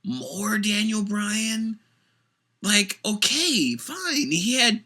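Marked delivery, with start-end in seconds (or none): 0.0-1.8 fed-up groan. Retold or imitated. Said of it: "you're like".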